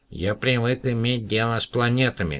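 a touch of proud boasting; proud space conqueror. A narrator's reading. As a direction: say it with little-alien pride. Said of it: "гордо сказал Потатам".

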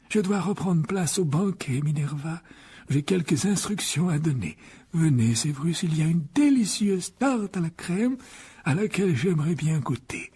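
measured warning to pupils; grave headmaster. A narrator's reading. The headmaster warns the boys, gravely. Voices Dumbledore.

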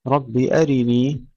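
slow melodic tajweed chant; Quranic recitation. Shown as a demonstration. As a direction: recite it slowly, drawing out the vowels. Recited verse. (تلاوة مجودة بطيئة)

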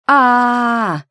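high, loud drawn-out "aaah"; anguished wail. A narrator's reading. Student panicking over a failing exam, anguished wail.

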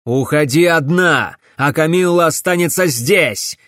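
loud, angry order; barked command. A narrator's reading. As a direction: roar it out as a final order. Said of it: "рявкнул барон". (властный гневный окрик)

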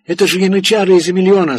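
gasping, frantic cry; breathless shout. Acted. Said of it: "задыхаясь, выкрикнул Фарлоу".

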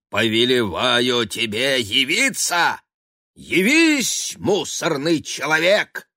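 high, loud, grandiose spell-casting; pompous wizard cat. Acted. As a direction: declaim like a self-important sorcerer.

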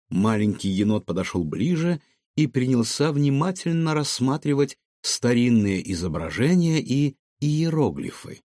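plain reading voice

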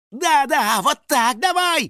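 high, loud eager encouragement; cheering on. A narrator's reading. House spirit egging the boy on, cheering. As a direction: egg him on in a loud squeal. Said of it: "подбадривал его домовой".

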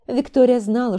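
ordinary delivery

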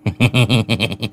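quick acted chuckle through a wide grin; rapid tittering he-he laugh; grinning giggle. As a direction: giggle cheekily. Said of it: "cười toe toét".

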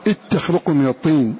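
إذ تخلق said "ittakhluq", the ذ swallowed by the ت; dh merged into t. Shown as a demonstration. Teacher showing students how it sounds: إذ تخلق as "ittakhluq". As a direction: push إذ into تخلق with a doubled t. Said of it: "هكذا".

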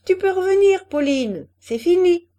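loud, high triumphant call; triumphant holler. A narrator's reading. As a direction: shout her back, victorious.

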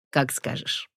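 soft, meek, resigned reply; meek agreement. A narrator's reading. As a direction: give in without protest, softly. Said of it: "безропотно согласился он". (тихо и безропотно)

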